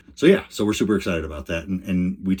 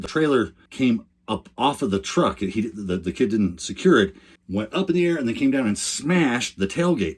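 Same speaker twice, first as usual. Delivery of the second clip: ordinary delivery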